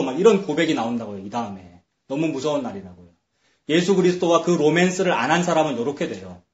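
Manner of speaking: normal talking voice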